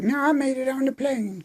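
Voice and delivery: put-on very high, fast, whiny girl voice; a hammed-up sulky teen dodging; whiny mock Emma. Mocking Emma refusing to talk about Kieran. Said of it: "She's like".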